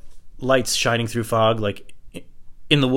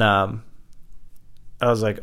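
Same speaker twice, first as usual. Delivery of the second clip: usual speaking voice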